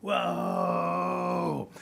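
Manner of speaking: long held "whoooa" on one note; drawn-out "whoa" imitation. Storyteller showing how a turtle runs, drawn-out whoa. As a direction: stretch out a whoa like a straining runner. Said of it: "they run like this".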